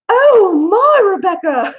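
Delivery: shrill-high, loud mock English exclamation; attempted English accent. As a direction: imitate a scandalized English mother-in-law, accent badly faked. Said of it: "I can't do the accent".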